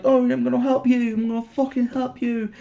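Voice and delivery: hammed-up helpful Elise; mock Elise. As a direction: play her as overeager to help. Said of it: "Elise is like".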